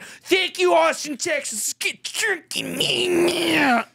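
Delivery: high, slow, laughing shout; mocking cartoon frontman. Friend mocking the sign-off, cartoonish slow shout. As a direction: drag it out like a cartoon character. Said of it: "you sound like a South Park character".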